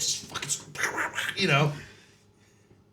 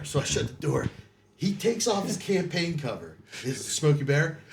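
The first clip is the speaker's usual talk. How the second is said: ordinary talk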